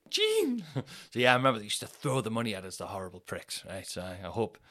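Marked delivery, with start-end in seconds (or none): 0.1-0.6 put-on old woman calling.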